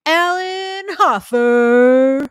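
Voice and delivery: loud, high, hugely drawn-out holler; long mock war cry; Leroy Jenkins battle cry. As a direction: bellow the name like a gamer charging in, stretching each word. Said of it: "that's the equivalent of Leroy Jenkins".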